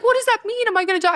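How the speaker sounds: high-pitched panicked questions, acted out; retold freak-out. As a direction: plead for answers in replayed alarm. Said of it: "I'm, like, freaking out".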